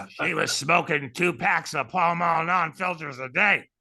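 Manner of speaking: slow, rough chain-smoker rasp; Madge's smoker voice. Retold or imitated. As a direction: talk like a lady who smokes two packs a day. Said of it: "she talked like this".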